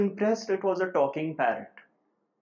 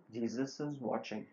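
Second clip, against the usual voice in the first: soft, lower-key mysterious warning; voz mais baixa; 3 semitones lower, 8 dB quieter than usual. Retold or imitated. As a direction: say it quietly, like a distant voice. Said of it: "the voice became louder".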